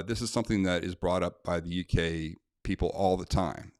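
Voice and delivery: usual speaking voice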